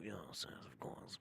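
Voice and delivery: hushed whisper of agreement; whispered aside. Pretend. Streamer agreeing with himself in a whisper. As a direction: breathe it to yourself. Aside after posh boast.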